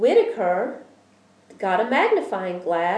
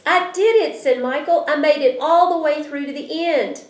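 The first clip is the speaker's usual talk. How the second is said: put-on thrilled child; 8 semitones higher, 6 dB louder than usual. A narrator's reading. Child triumphant after spelling bee.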